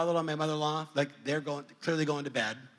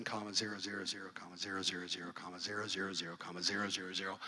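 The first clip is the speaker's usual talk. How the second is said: low, hushed run of repeated zeros; absorbed counting; 8 semitones lower, 10 dB quieter, faster than usual. Retold or imitated. Son writing zeros down the beach, hushed absorbed counting. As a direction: recite it softly on one low note, on and on.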